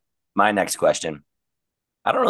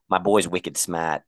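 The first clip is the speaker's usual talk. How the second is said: drawn-out mock Boston accent; slow it down in Boston style; 3 semitones lower, slower than usual. Quoting a movie to friends, slow mock Boston accent. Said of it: "Boston accents are sweet".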